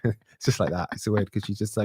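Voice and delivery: ordinary delivery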